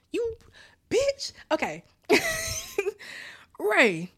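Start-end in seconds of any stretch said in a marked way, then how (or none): 0.1-1.3 squeaky insult. Acted. Calling Ray out.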